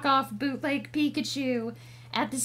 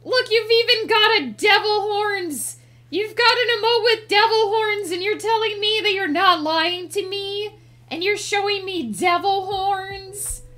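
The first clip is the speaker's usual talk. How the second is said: higher, louder playful accusation; indignant, hammed-up disbelief; mock outrage; 5 semitones higher, 6 dB louder than usual. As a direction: protest as if scandalized by an obvious lie. Playfully accuses chatter of lying.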